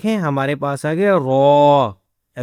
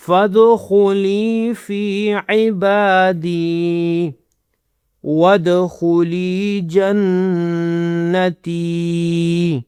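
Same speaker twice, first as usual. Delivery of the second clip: very slow melodic tajweed chant; closing the lesson with a chanted verse; Quran recitation; much slower than usual. Shown as a demonstration. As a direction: draw each word out reverently. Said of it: "teacher recites final verses".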